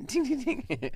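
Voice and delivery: high sing-song ding ding like a hand bell; imitated bell ringing. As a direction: ring out the bell sounds in a high voice. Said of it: "and he's saying".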